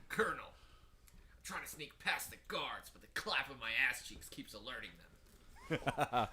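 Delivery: gravelly gargled Snake impression, slowed; Solid Snake voice. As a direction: growl it like you're gargling marbles. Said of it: "I'm gargling marbles".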